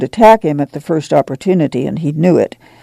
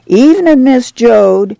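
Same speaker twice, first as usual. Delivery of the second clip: much higher and louder friendly greeting; a cheerful neighbor calling out hello; neighbor's greeting; 7 semitones higher, 3 dB louder than usual. A narrator's reading.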